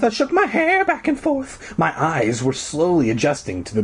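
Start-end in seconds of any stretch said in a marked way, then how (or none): 0.0-1.8 very high voice. Acted. Retake in strange voice.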